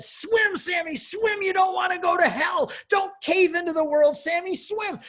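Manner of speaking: high, loud cheering shout at a little brother; big sister shouting encouragement; urgent shout. Retold or imitated. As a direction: yell him on, don't let him quit. Sister urging brother to keep swimming.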